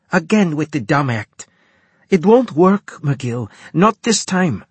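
high, loud, seething woman officer; furious commander. A narrator's reading. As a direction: tear into him, furious. Turov furious accusation.

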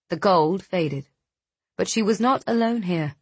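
ordinary delivery, nothing marked

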